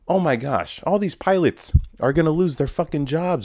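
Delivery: high-pitched, incredulous lament; worked-up, high-voiced protest; dismayed exclamation. Real, not acted. Lamenting pilots losing their jobs.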